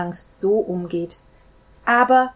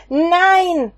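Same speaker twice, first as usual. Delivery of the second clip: much higher and louder, a desperate cry; panicked shout; 9 semitones higher, 8 dB louder than usual. Acted. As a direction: scream the denial in panic. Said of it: "schrie er". (panischer Schrei)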